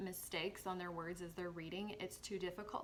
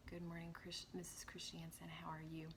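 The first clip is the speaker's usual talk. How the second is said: expressionless greeting; 2 semitones lower, 8 dB quieter than usual. Shown as a demonstration. Teacher showing students a greeting without expression, soft monotone. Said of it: "Or do you say it like this".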